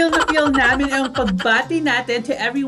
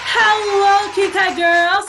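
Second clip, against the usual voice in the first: high, loud cheery hello to open the show; bubbly host opening; excited greeting; 7 semitones higher, 3 dB louder, slower than usual. Real, not acted.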